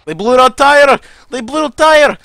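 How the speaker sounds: high, loud panicked yell in a mock Russian accent; panicked shout. Soldier yelling to his squad that they blew out the tire, panicked. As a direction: yell it in alarm as the tire blows.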